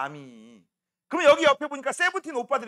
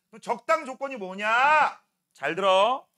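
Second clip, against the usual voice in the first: normal talking voice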